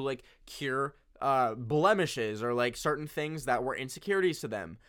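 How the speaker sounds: ordinary talk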